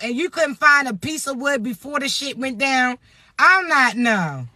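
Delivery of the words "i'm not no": usual speaking voice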